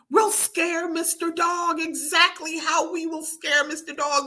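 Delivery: put-on high, excited little-girl voice; eager plotting. A narrator's reading. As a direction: pitch it up and announce the plan. Salma announces her plan.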